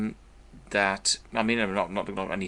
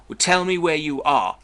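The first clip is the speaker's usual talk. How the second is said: imitating caller; 6 semitones higher, 6 dB louder than usual. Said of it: "You know".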